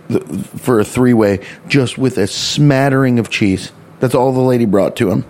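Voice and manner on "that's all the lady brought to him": ordinary talk, nothing marked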